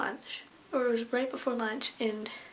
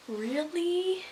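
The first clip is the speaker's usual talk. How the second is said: higher, rising disbelief; incredulous; 6 semitones higher than usual. Retold or imitated. Student doubting the teacher's reasoning, incredulous. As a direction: question it in mock disbelief, pitch climbing.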